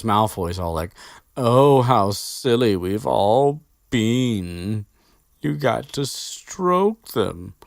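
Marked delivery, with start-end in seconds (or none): 1.4-7.5 slow, high, louder mocking drawl; sneering Malfoy. Malfoy sneering at Hagrid's lesson, slow and mocking. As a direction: drag it out in sarcastic scorn.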